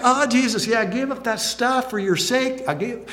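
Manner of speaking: mock-giddy thanks, high and bright; giddy gushing. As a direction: gush to Jesus like an excited newlywed. Voicing a gleeful disciple to Jesus.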